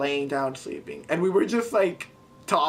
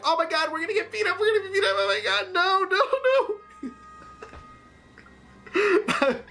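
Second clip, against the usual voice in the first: much higher, fast, half-crying panic; panicked retold scream; 13 semitones higher, faster than usual. Sure they will be beaten up by the charging guy, shrieking high. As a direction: squeal in terror, breaking into laughing sobs.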